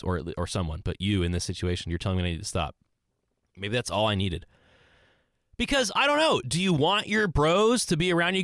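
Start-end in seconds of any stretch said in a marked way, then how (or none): none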